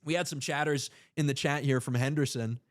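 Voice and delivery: usual speaking voice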